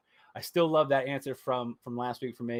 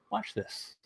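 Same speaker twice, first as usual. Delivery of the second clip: high, hushed sly taunt; mock villain Disney; 9 semitones higher, 9 dB quieter than usual. Disney gloating at rivals, quiet sly taunt. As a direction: whisper it like a scheming corporation.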